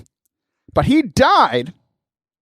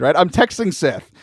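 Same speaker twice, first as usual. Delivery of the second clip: ordinary talk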